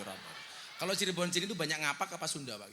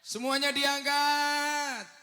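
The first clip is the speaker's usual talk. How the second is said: drawn-out, louder call to the crowd; 8 semitones higher, 6 dB louder, much slower than usual. Real, not acted. Preacher urges the crowd to raise their phones, drawn-out call. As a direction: stretch it out to rally everyone.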